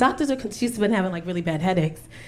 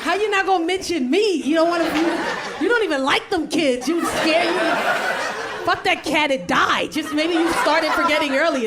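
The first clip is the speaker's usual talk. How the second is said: mock indignation; 5 semitones higher than usual. Sibling left off her twin's list, mock indignant. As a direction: protest as the snubbed twin, hammed up. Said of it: "Why are you mad?".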